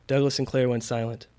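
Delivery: usual speaking voice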